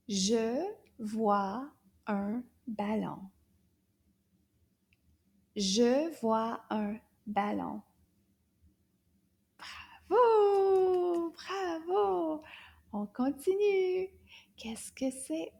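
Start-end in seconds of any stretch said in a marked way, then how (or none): 0.1-7.8 Je vois un ballon drawn out slowly; slow model. Shown as a demonstration. Teacher to class, slow model sentence. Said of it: "say the whole sentence". 10.1-14.1 cheering praise. Real, not acted. Cheering the pupil.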